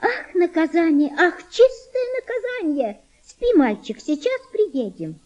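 put-on high girlish grown-up-style lament; sighing, copying mother. A narrator's reading. Girl laments and hushes boy like mother, sighing. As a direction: sigh it out like a child mimicking mum. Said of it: "прибавила со вздохом по примеру матери".

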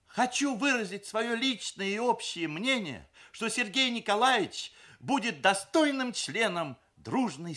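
high, loud meeting-speech delivery; bride's formal declaration. A narrator's reading. Люда concluding her testimonial, declaiming officially. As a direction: proclaim it like a resolution.

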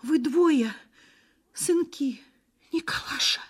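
high, feeble wail of an anxious mother; high-pitched, helpless lament; helpless dismay. A narrator's reading. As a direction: gasp it out high, hopelessly distressed. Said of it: "слабо ойкнув, всплеснула безнадёжно руками".

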